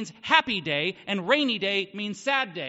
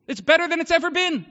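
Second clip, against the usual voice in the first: louder, higher joyful proclamation; exultant; 5 semitones higher, 8 dB louder, faster than usual. Real, not acted. Pastor telling his congregation life is glorious, exultant. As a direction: proclaim it with full joy.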